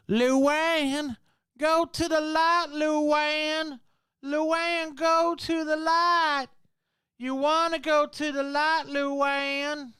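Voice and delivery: high, far-off eerie medium calling Luann; Poltergeist medium voice. Retold or imitated. Recalling Poltergeist three, calling Luann into the light, in a high eerie medium voice. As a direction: call her toward the light like a spooky movie psychic. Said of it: "Poltergeist three".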